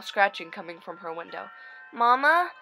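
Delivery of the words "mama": scared call. Acted. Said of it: "she asked out loud".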